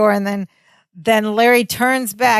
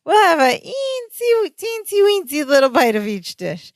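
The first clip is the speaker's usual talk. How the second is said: mimicking Larry; 7 semitones higher than usual. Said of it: "Balki mimics him".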